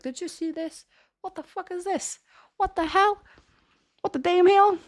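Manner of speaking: high-pitched disbelief, starting hushed and building; mock outrage. Student imagines complaining to the professor, in mock outrage. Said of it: "I would love to talk like this".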